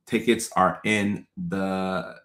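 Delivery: ordinary talk, nothing marked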